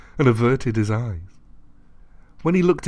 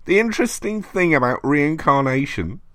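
rasping voice; 6 semitones higher than usual. A narrator's reading. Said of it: "rasped the voice".

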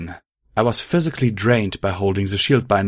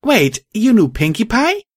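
high, louder, surprised question; high-voiced startled yell; astonished shout; 14 semitones higher, 5 dB louder than usual. A narrator's reading. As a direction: shout it in disbelief, pitch way up. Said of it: "I shouted over my magic woozily".

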